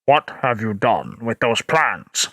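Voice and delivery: very low, menacing bass; James Earl Jones. Shown as a demonstration. Streamer doing a deep James Earl Jones line. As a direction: demand it like a dark lord. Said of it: "I will do my best to do James Earl Jones".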